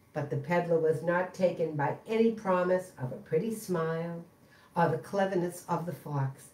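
ordinary delivery

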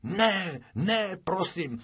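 a pupil standing her ground; insistent girl. Acted. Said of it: "trvala na svém Krupičková". (umíněná holčička, mnohem výš)

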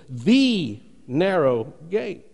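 very slow, "the" drawn out and held apart; stress on THE. Shown as a demonstration. Preacher pointing out the emphasis, "the" stressed and isolated. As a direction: stretch "the", then place each word separately. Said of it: "Notice the emphasis".

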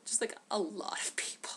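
soft stage whisper; whispered aside. Real, not acted. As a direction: lean in and whisper it. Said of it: "whispered aside".

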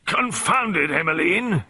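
loud, high-pitched blustering indignation; spluttering colonel's roar; roared outburst. A narrator's reading. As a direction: explode at your wife in outrage. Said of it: "he roared".

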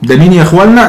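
ordinary talk

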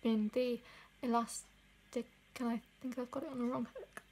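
talking with fingers at lips; slightly muffled lip sounds; finger pulling lip aside. Real, not acted. Seen 0: finger pulls lower lip aside, then fingers held at lips.

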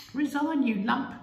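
very high, loud heckle; angry yell. Acted. Angry lady in the mob heckling the Prime Minister, yelled. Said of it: "one angry lady yelled".

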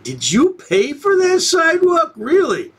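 high, scoffing disbelief; mock incredulous. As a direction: scoff at her claim in a jump up in pitch. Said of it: "And you're like".

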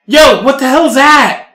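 high, loud startled yell; blurting out in alarm; shocked. Retold or imitated. Retold outburst.

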